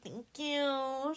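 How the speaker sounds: high, rising put-on thank-you; mock sweet thanks. Lily to the camera with her necklace, mock sweet. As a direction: mimic smug thanks, lilting upward.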